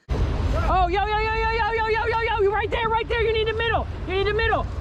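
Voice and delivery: high yelled directions; calling from far away. Real, not acted. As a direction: shout instructions across the water. Calling directions across the river.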